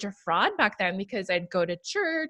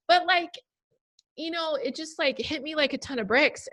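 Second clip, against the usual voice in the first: teary, still choked up; after crying; 7 semitones higher, 3 dB quieter than usual. Real, not acted. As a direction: speak through fading tears. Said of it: "Sorry, I'm gonna teary".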